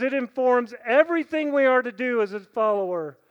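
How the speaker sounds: loud, ringing preaching; full-voiced sermon emphasis; preaching at full force. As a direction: drive the point home.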